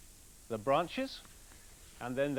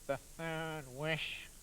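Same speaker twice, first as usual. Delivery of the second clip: slow, softer glum man; gloomy; 5 dB quieter, much slower than usual. Acted. Woodcutter tells his wife the only answer, slow and gloomy. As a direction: sigh it out, defeated. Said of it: "said the man gloomily".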